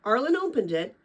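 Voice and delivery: ordinary delivery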